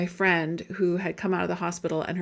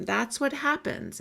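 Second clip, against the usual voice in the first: ordinary talk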